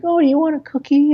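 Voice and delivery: put-on high, sweet little-old-lady offer; imitating his sweet grandma offering cookies. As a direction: coo it like a doting grandma. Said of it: "stereotypical grandma stuff".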